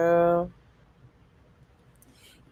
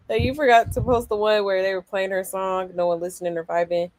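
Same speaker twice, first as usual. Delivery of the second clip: ordinary talk, nothing marked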